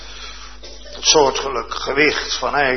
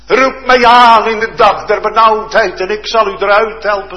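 much louder and higher, fervent preacher; preaching at full force; 8 semitones higher, 10 dB louder than usual. Acted. Preacher stirring the congregation with the blind man's cry, loud and higher. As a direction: thunder it out, building in passion.

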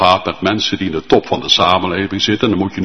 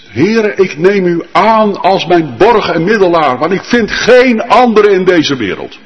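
higher and louder, pleading preaching; full-force preaching; 8 semitones higher, 6 dB louder than usual. Acted. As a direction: preach it out loud with urgency. (vurig en luid preken)

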